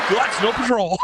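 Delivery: laughing Scottish line, high and loud; Scottish accent. Retold or imitated. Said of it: "I like to say this to my Scottish friends".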